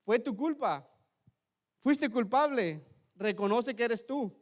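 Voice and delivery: acusación recreada en voz más alta y aguda. Retold or imitated. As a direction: acusa con fuerza, golpe a golpe. Acted accusation quoted from his superior. (acusación enérgica)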